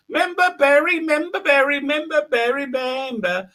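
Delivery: high squeaky little creature chant; Member Berry. Retold or imitated. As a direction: chirp it in a tiny high voice. Imitates Member Berries.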